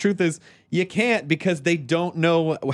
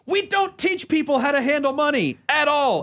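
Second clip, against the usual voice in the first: high, emphatic outburst; impassioned rant; 9 semitones higher than usual. Real, not acted. Host ranting about schools not teaching money, impassioned. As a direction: ride the exasperation high through every word.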